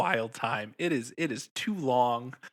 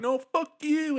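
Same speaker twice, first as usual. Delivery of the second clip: mock defiant retort; 3 dB louder, slower than usual. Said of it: "he's just like".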